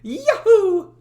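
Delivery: high, loud Mario-style yahoo; cheery Yahoo. Acted. Dev testing a character voice, loud Mario-like whoop. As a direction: shout it like a jumping plumber. Voice test before recording lines.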